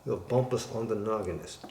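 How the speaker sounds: ordinary talk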